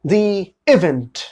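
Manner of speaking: The said as thee, very slow; "thee" before vowel. Shown as a demonstration. Teacher modelling the thee sound, very slow. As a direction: draw out The event as thee event. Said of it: "तब sound आएगा दी".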